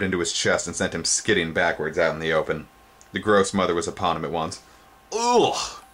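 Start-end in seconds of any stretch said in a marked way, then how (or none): 5.1-5.8 very high disgusted ugh; sharp high cry of disgust. Acted. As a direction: recoil with a shrill ugh. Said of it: "she exclaimed".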